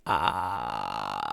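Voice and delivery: very low sustained creaky fry; long buzzy fry sound; vocal fry. Shown as a demonstration. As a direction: hold a low, crackling fry. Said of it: "vocal fry is that sort of".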